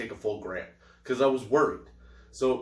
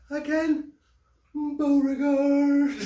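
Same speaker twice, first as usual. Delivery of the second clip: drawn-out announcer intro; 5 dB louder, much slower than usual. Acted. Host introduces guest, drawn out.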